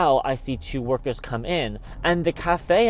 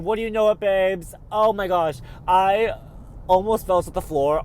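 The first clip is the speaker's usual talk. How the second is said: excited relief; 5 semitones higher than usual. Real, not acted. Relief after finding card.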